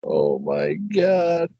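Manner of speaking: high, slow stunned reaction; drawn-out oh my God. Real, not acted. Hearing the tooth story, the friend says it high and slow in disbelief. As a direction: stretch it out in disbelief.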